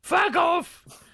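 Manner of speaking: mock outraged shout. Real, not acted. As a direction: yell it in fake outrage, laughing.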